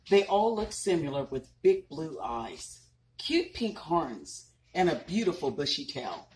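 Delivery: gentle storybook narration; reading to children. A narrator's reading. Mother reading a unicorn story to her children, storybook voice. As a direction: tell it like a bedtime story to little ones. Reads picture book aloud.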